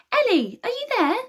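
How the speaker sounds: high, loud, eager question; eager call. A narrator's reading. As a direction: call to him, hoping. Calling to waking boy.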